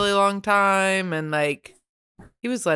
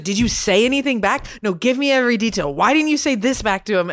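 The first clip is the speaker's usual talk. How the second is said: higher, louder indignant questioning; pissed-off wife; 5 semitones higher, 4 dB louder than usual. Retold or imitated. Wife grilling her husband, mock indignant. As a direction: imitate a wife demanding every detail. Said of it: "She's so pissed".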